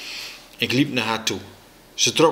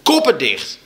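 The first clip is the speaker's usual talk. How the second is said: raised, loud angry command; schreeuwen; 4 semitones higher, 5 dB louder than usual. A narrator's reading. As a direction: yell it to silence the quarrel. (boos geschreeuwd bevel)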